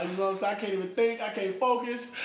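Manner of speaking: ordinary talk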